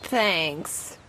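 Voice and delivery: a soft, low croak. A narrator's reading. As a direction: croak it out weakly. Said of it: "croaked Sister".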